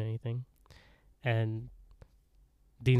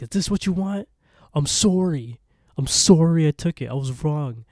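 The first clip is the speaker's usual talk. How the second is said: high, loud mock apology; acted desperate plea; 6 semitones higher, 6 dB louder than usual. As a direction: beg them, crying out the sorry.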